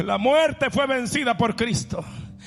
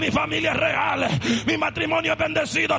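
preaching at full force; 9 semitones higher than usual. Acted. Pastor proclaiming to the congregation, preaching at full force. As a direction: preach it out to the whole church.

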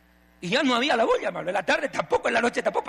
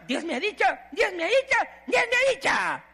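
climbing high mock voice; hammed-up pious sister; mocking false prophetess; 9 semitones higher than usual. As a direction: squeak out the fake revelation.

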